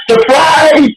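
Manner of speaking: high, hammed-up announcement; mock surprise. Comic ambushing four elderly men, mock surprise. Acts out springing a surprise show.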